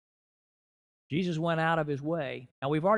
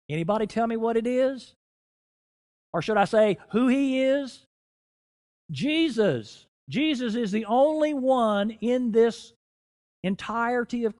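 raised, louder preacher's delivery; impassioned preaching; 7 semitones higher, 6 dB louder than usual. Real, not acted. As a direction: drive the point home to the congregation, higher and forceful. Preacher builds to the climax.

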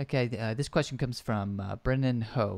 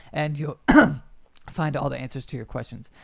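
coughing mid-sentence; 2 semitones higher, 6 dB louder than usual. Real, not acted. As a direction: cough breaks up the words. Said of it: "there's a fly in here".

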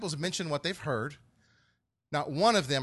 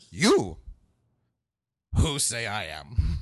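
YOU punched loud, then a long pause; a big, loud "You" set apart from the rest; stress on YOU; 3 semitones lower, 9 dB louder, much slower than usual. Retold or imitated. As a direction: hit "You" hard, wait, then slowly finish the question. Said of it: "he's putting the emphasis on you".

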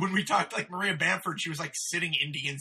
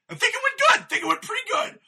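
much higher, louder, timid voice; squeaky unsure comic; 9 dB louder than usual. Retold or imitated. Comic asked how it went answers in a squeaky unsure voice.

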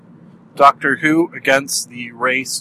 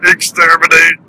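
louder, rasping robot Dalek voice; Dalek meets Fat Albert; 4 semitones higher, 7 dB louder than usual. Pretend. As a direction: growl it like a goofy Dalek. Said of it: "a Dalek meets, um, Fat Albert".

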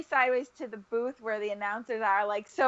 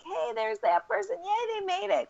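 lightly voiced, much higher delighted announcer; mock announcer cheering runners on, higher; imagined announcer cheer; 10 semitones higher than usual. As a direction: play the announcer spotting a runner, a bit excited.

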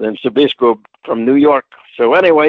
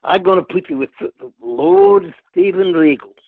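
Dusty impression; 5 semitones higher than usual. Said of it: "he calls me in the office".

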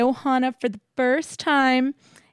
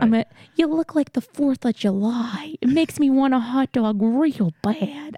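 lightly put-on Southern drawl; Jennifer Coolidge impression; 2 semitones higher than usual. Host trying a Southern drawl for her co-host. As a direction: ease into a breathy-free lazy Southern drawl, half-hearted. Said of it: "That was like a Southern drawl, Jennifer Coolidge".

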